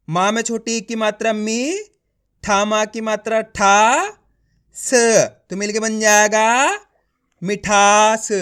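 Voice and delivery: teacher's slow build-up to a drawn-out "मिठास"; letter-by-letter sounding out. Shown as a demonstration. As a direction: rise on "मी", stretch "ठा" slowly, isolate "स", then blend "मिठास". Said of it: "एक एक अक्षर का उच्चारण करके पढ़ रहा हूँ".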